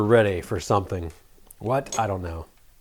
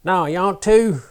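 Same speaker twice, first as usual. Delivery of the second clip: run-together Southern slang, rising higher and louder; 6 semitones higher, 5 dB louder than usual. Shown as a demonstration. Vlogger demonstrating old slang terms in a Southern accent. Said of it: "get back all my slang terms like".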